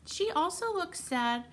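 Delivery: ordinary delivery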